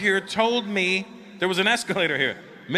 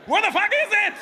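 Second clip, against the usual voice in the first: much higher, louder exasperated demand; exasperated yell; 4 dB louder than usual. Retold or imitated. Comedian fed up with the clerk, laughing exasperated shout.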